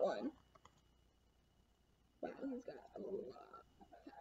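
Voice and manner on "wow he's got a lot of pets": whisper. Real, not acted. Reading aside, hushed.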